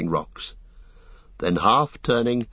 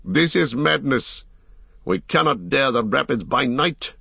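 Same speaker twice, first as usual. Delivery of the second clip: raised, higher-pitched protest; shouted protest; 5 semitones higher than usual. A narrator's reading. Boromir protests to the leader, raised voice. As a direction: object urgently, voice lifted. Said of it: "shouted Boromir".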